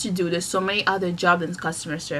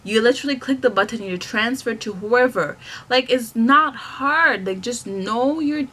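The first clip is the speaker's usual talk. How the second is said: normal talking voice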